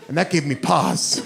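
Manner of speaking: ordinary talk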